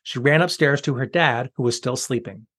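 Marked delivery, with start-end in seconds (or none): none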